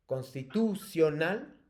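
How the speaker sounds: "constitucional" slow, TU and CIO drawn out, rising at the end; "constitucional" in slow chunks with a rising finish; marked syllable stress. Shown as a demonstration. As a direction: stretch the middle of "constitucional" and end questioning. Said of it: "te las voy a pronunciar todas con el acento fuerte".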